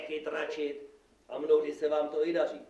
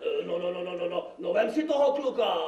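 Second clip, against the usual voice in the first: eager urging; 2 semitones higher, 5 dB louder, faster than usual. Acted. Magistrate urges devil to take the boy, eagerly. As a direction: stammer in, then urge loudly.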